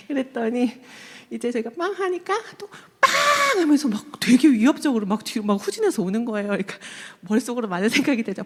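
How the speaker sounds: usual speaking voice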